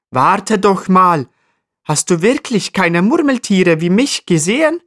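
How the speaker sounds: high, insistent little marmot voice; high-pitched pleading question; insistent appeal. A narrator's reading. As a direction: call after someone walking off, drawing out the first words. Marmot calls after departing ibex.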